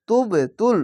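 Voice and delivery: তুব তুল with a bouncing push on the "b"; qalqalah. Shown as a demonstration. Teacher demonstrating qalqalah, তুব তুল with echoing "b". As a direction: bounce the "b" of তুব, then "tul". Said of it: "কলকলা ধাক্কা মেরে নিচে sound আসবে".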